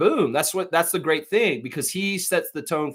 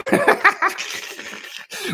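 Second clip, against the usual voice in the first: hearty laugh. Real, not acted. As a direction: burst of laughter at oneself. Amused at his own pick.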